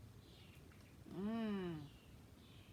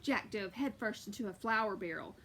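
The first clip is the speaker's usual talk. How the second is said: plain reading voice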